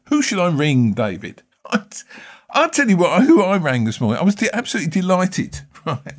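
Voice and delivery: usual speaking voice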